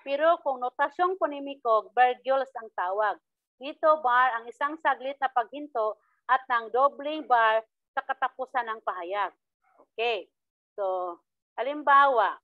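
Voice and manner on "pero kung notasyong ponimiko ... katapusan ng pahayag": ordinary talk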